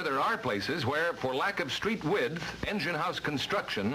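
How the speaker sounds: ordinary talk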